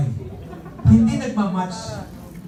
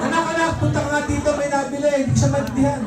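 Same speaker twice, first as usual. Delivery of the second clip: higher calling voice; calling his son; 8 semitones higher, faster than usual. Retold or imitated. Father calling the son to the store, higher. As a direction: call across the house for help. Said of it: "tinawag yung anak".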